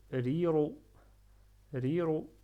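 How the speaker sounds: slow, deliberate Riro; Riro, light then heavy r. Shown as a demonstration. Teacher reading a practice word, Riro with both r sounds. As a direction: contrast thin Ri with full ro in Riro. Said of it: "Ra avec kasra".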